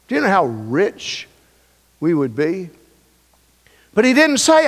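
normal talking voice

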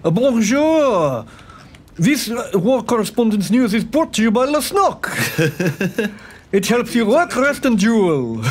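put-on French-accented news reporter voice; Lesnok the war correspondent. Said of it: "in Lesnok's war correspondent's voice".

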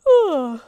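loud, voiced storybook yawn; big yawn. A narrator's reading. Head is ready for a nap, yawning. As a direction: let out an exaggerated yawn.

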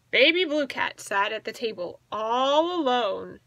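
usual speaking voice

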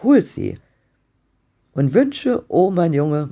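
high, loud, pleading outburst; gasped urging. A narrator's reading. Wife begs husband to wish boy back, frantic. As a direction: plead urgently, pitch leaping. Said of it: "keuchte die, seine Frau".

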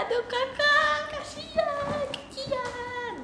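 high, slow, sung kasihan; singsong pity. Real, not acted. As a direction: coo it in mock pity. Pitying the gifter, drawn out. (mengasihani dengan nada tinggi bernyanyi)

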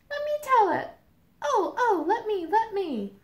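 high, eager cries of two states jostling to go first; eager squabbling. Acted. States squabbling to tell the story, eager high voices. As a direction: beg to be the one who tells it. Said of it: "Ohio and Colorado are fighting".

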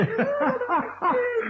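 long high laugh. Acted. Eder laughing at Pumuckl buried in snow, a long high laugh.

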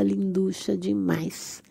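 ordinary delivery

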